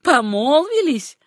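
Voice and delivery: slow, high, mocking question; scornful, swooping incredulity; feigned disbelief. A narrator's reading. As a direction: stretch it out as if absurd. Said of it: "делая вид, будто не верит этому".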